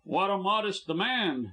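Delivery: jeering. A narrator's reading. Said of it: "jeered Cass".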